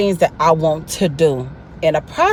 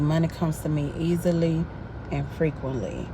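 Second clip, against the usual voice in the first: softer, almost murmured affirmation; quiet mantra; 11 dB quieter than usual. Retold or imitated. Retelling her calming money mantra, much softer. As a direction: say it to yourself, barely aloud. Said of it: "And I would say".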